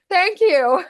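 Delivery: high, loud thank-you played for laughs; mock-proud thanks. Actor praised for the wrong accent at audition, mock-proud thanks. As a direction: chirp it high, taking the wrong compliment. Acts awkward thanks for mistaken compliment.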